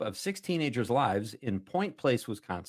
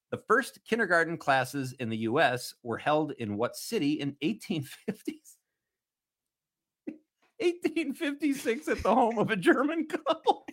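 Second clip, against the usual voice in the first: reading through giggles, rising high; can't keep a straight face; laughing while reading; 7 semitones higher than usual. Real, not acted. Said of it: "can't even read the question with a straight face".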